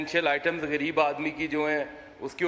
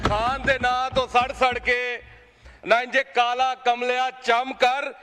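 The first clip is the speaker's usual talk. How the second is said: building poetic declamation; 7 semitones higher, 4 dB louder than usual. Acted. MP taunts Imran Khan's critics in verse, rising declamation. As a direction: build the couplet up into a triumphant retort. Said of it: "मैं एक शेर पढ़ के".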